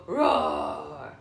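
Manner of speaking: playful drawn-out storybook growl; wolf roar. Shown as a demonstration. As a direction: play the big wolf roaring for a child. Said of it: "Does the wolf go".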